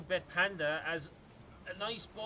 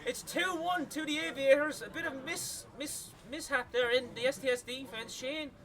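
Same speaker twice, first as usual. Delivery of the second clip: excited commentary; 8 semitones higher, 6 dB louder than usual. Real, not acted. Goal call after a score.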